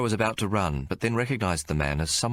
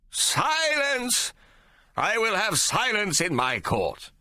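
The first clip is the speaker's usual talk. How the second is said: much higher, slow commanding judge; judge demanding silence; much slower than usual. Acted. Judge demanding silence from the noisy gallery, commanding. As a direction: bring the court to order. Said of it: "he demanded".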